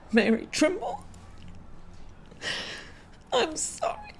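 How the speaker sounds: real grief breaking through the words; breaking into tears. Real, not acted. Host naming her late mother, voice breaking into real crying. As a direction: voice cracks high as the tears come. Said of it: "I'm like an emotional wreck".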